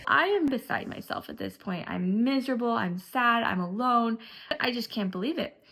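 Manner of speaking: usual speaking voice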